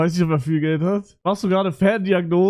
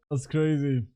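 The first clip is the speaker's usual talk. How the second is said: ordinary talk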